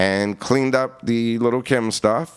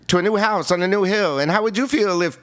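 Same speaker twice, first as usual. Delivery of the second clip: higher rhythmic rap delivery; 8 semitones higher than usual. Retold or imitated. Recalling a rapper's reference track for [name], imitated higher rap flow. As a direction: imitate a rapper's demo, pitched up and in cadence. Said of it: "funny to hear M doing".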